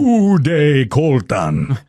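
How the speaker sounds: spooky cartoon count voice; a hint of a Transylvanian ghost. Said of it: "the ghost sounded all Transylvanian cartoon vibe".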